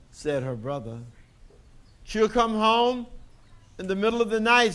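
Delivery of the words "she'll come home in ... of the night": smug, superior sister, a touch higher; big know-it-all voice. Acted. Sister lording it over Daisy, know-it-all tone. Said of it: "in her big know-it-all voice".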